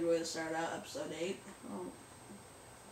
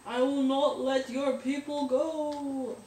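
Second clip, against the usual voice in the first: mock-heroic proclamation; 4 semitones higher, 8 dB louder than usual. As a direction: proclaim it like a hammy hero. Mock Batman proclamation, drawn out.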